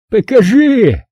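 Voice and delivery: sharp high grabby command; snatch it with your voice; demanding Rob. A narrator's reading. Rob demanding Max's comic, a sharp bossy order.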